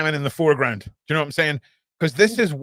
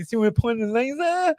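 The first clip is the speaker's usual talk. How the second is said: mocking Danny; 8 semitones higher than usual.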